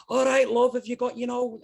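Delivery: high, loud chatty switch back to everyday talk; grandma's normal voice. Retold or imitated. Grandma turning from the phone to chat with family. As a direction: drop the posh and chat like at home. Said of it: "and then she'd turn around and speak completely differently".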